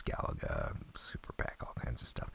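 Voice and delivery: hushed list of extras; whispered aside. Real, not acted. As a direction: trail off into a whisper. Listing games, dropping to a whisper.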